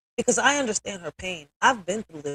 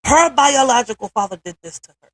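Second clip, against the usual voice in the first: louder, higher, emotion breaking through; voice cracking; 5 semitones higher, 8 dB louder than usual. Real, not acted. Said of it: "y'all might hear my voice crack".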